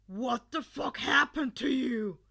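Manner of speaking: loud, intense shocked question; low demand. Acted. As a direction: demand it with menace. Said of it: "his voice lower than usual".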